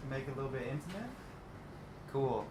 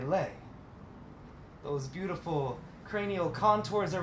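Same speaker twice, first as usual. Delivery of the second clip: higher, rolling spoken-word delivery; poetry recital; 7 semitones higher than usual. Acted. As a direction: recite it as verse, each phrase rising then falling. Resumes reading the poem.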